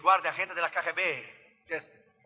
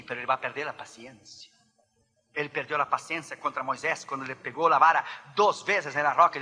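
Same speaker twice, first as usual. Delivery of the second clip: ordinary talk, nothing marked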